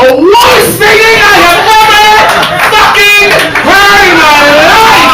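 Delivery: high, loud disbelieving yell; incredulous shout. Acted. Describing the worst singing of his life, shouting in disbelief. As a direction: shout it like the singing still hurts.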